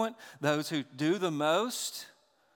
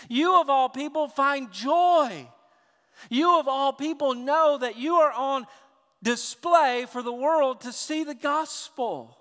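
loud, higher, urgent sermon push; fervent preaching; 8 semitones higher, 8 dB louder than usual. Real, not acted. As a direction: drive the point home with full force. Preaching at full force.

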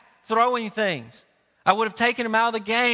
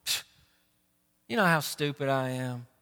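lightly acted sheepish self-rebuke, lower and softer; Jonah backing down; 7 semitones lower, 5 dB quieter than usual. Preacher imagining Jonah admitting his foolishness, sheepish and subdued. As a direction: wave it off as your own silliness. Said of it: "Jonah's response isn't".

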